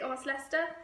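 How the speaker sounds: ordinary delivery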